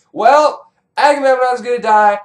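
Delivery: high, loud proclamation; blurted prophecy. Acted. Prophetess telling everyone who will die, loud proclaiming. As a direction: blurt out the doom.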